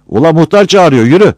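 high, loud call across the square. Acted. Villager yells that the headman is calling, high and loud. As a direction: summon him loudly.

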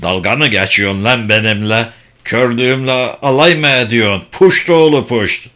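put-on old father's indignant outburst; scold, building to a loud curse; acted cursing that swells at the end; angry old man. Duped blind father swears at son.

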